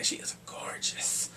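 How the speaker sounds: soft, slow whisper. Real, not acted. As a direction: breathe it as an aside. Whispered aside about the actress.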